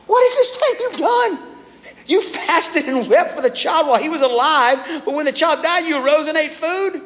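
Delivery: high-pitched, astonished outburst; incredulous questioning. Acted. Servants questioning David's sudden meal, incredulous. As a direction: demand an answer, voice shooting way up.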